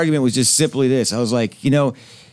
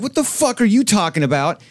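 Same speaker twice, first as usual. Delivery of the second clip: retold drunken outburst; 7 semitones higher than usual. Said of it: "I was drunk and I fucking went off".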